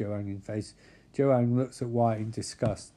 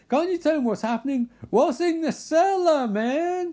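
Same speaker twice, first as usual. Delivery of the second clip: very high exasperated yell; shrieky acted interrogation, loud; heated demand; 5 dB louder than usual. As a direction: demand answers in hammed-up outrage.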